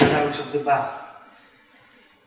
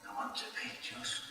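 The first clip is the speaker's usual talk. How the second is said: hushed, emotional; 2 semitones lower, 17 dB quieter than usual. Retold or imitated. Son's moving words, retold.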